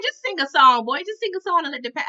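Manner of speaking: brisk dismissal. Retold or imitated. Foster mom telling the boy to sing, briskly dismissive. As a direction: rush it out as a breezy command.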